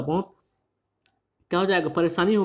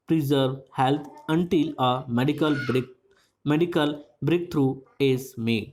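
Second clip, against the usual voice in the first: very slow, careful reading of English text; measured dictation pace; slow reading aloud; much slower than usual. Shown as a demonstration. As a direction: read it out slowly for the class to follow. Reads English news text slowly.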